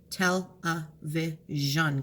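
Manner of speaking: slow, even "Tell a vision"; slow syllable split. Shown as a demonstration. Teacher to students, slowly sounding out syllables. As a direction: lay out "Tell a vision" beat by beat. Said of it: "keeping jean together as one syllable".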